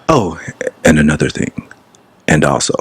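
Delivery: deep mock-pundit voice; Stephen A. Smith. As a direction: teasingly copy a friend's low, professional debate voice. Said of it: "don't talk like this all the time".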